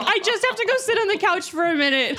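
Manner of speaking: high, mock-tearful retelling with laughter; whimpered exit line, half laughing; sobbing. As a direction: imitate giving up in tears. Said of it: "I was sobbing".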